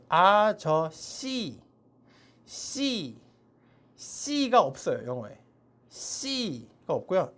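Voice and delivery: C said slowly as Korean 시; drawn-out Korean 시 for C; wrong Korean 시. Shown as a demonstration. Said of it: "이렇게 발음하면 안 돼요". (C를 한국식 시로 천천히)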